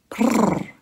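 high rolled purr; a short, high prrr; cat purr. A narrator's reading. Cat purring at Bloom.

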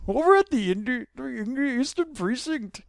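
a mixed-up mock accent; accent drifting to Indian. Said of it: "sound like I'm Indian now".

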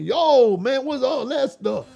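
high, loud exclamation; startled protest. Retold or imitated. Man kissed by his friend, startled protest. Acts out reaction to being kissed.

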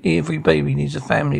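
usual speaking voice

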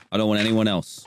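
normal talking voice

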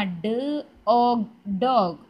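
ഡ്, ഓഗ്, then ഡോഗ് blended, slow and loud; sounding out. Shown as a demonstration. Teacher showing children how to read by sounds, sounding out ഡോഗ്. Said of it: "ഈ sound പറഞ്ഞ് തന്നെ".

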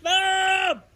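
high, drawn-out yell; long high-pitched holler for a parent; yelling for mom. Real, not acted. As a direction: call out high to summon mom. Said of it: "I yelled pretty loud".

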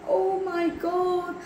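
high, slow gasp of dread; aghast. Acted. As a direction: draw it out in horror. Horror at the moving broom halves.

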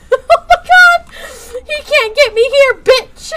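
high, loud shrieking taunt; giddy panic. Real, not acted. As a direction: shout it back while still panicking. Escapes enderman, taunts it.

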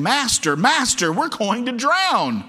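high, loud cry for help; urgent, high-pitched wail, stretched at the end; panicked cry. Acted. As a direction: shout in alarm as if sinking. Disciples wake Jesus in storm.

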